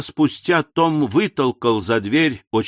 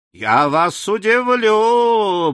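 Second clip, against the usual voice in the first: slow and higher, drawing it out as a warning; mysterious warning; 9 semitones higher, much slower than usual. A narrator's reading. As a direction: tease her with a secret, slowly. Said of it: "предупредил Том". (медленно и таинственно предупреждает)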